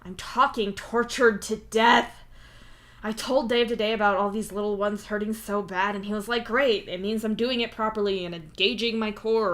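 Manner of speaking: loud, whining complaint; whiny. Acted. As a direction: whine about the pain. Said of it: "Solix whines".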